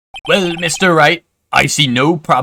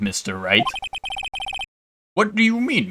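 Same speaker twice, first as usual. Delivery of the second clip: usual speaking voice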